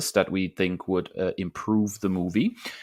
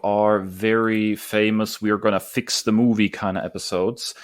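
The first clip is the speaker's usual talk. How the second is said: show host intro; 3 semitones higher, 5 dB louder than usual. Acted. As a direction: open the segment like a show host. Host opens segment after break.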